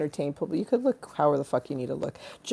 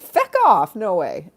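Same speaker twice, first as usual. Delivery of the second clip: mock disbelief; 3 semitones higher, 7 dB louder, slower than usual. Reacting to a funny word, mock disbelief. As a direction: exclaim it in exaggerated disbelief.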